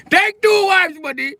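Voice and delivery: put-on high, loud Indian accent shout. Indian husband yelling at the man to take two wives, high loud Indian accent. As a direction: yell it after him, high and pushy in an Indian accent.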